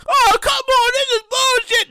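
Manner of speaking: high, whiny customer complaint; mock protest. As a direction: protest the ten cents, high.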